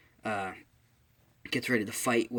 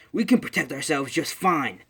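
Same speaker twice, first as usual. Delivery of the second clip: loud, higher indignant comeback; acted defiant retort; 4 semitones higher, 7 dB louder than usual. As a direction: push back hard, in character. Bakugo retorts to All Might.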